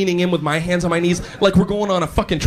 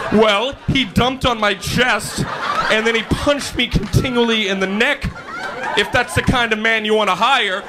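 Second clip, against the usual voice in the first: indignant reference giver; 5 semitones higher than usual. Acted. Former boss warning a new employer, mock indignant.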